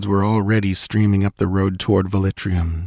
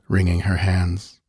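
usual speaking voice

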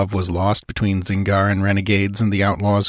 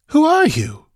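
much higher-pitched, sharp demand; demanding woman; 4 dB louder than usual. A narrator's reading. Sancha challenges the stranger on deck, high and demanding. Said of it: "she demanded".

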